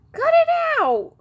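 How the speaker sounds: a high, loud yelp of protest; pained yell. Acted. Alex, punched in the gut by Elaine, yelps a high loud protest.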